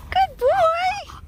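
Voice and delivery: squeaky excited praise for the dog; high-pitched praise. Real, not acted. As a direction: sing it up high and bright.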